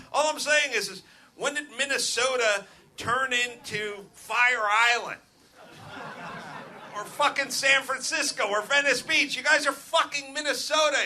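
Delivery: mock outraged rant. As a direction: scold a whole state in jest, building to a shout.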